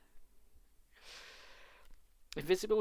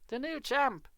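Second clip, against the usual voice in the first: triumphant; 11 semitones higher, 4 dB louder, slower than usual. A narrator's reading. Grin widening, proud.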